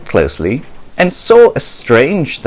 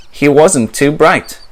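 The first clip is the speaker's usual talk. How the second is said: ordinary delivery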